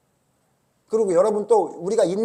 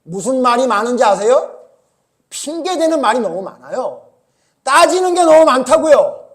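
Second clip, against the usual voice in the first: loud, higher, driving sermon voice; fervent preaching; 7 semitones higher, 12 dB louder than usual. Acted. Preacher scolding the congregation, loud fervent preaching. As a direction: press the point hard to the congregation.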